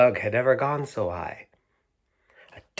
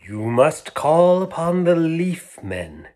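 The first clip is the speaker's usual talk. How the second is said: higher, louder, slow mysterious voice; mysterious toy; 7 semitones higher, 8 dB louder, slower than usual. Acted. Mysterious toy urging the insects, slow and solemn. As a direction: intone it like an old secret. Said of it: "said the mysterious long-lost toy".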